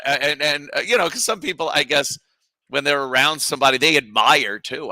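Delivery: ordinary talk, nothing marked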